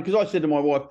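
ordinary talk, nothing marked

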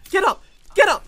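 high, loud command; urgent shout. Real, not acted. Player yelling at downed teammate, urgent shout.

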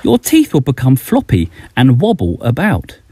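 plain reading voice

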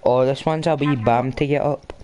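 usual speaking voice

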